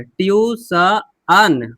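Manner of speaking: ट्यूशन slowly in parts, tyu-sha-an; sounded-out pronunciation. Shown as a demonstration. Teacher breaks ट्यूशन up slowly for learners, ending "an". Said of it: "अन इसको पढ़े".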